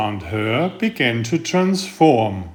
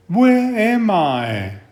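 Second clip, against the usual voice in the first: higher, slow owlet voice, worn out; weak, worn little owl; 7 semitones higher, slower than usual. Acted. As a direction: ask faintly, exhausted after the trip. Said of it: "Worn and weak".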